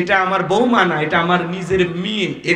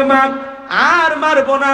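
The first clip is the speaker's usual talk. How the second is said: raised, pleading cry; wailing plea; 6 semitones higher than usual. Acted. Preacher voicing a sobbing son begging his mother.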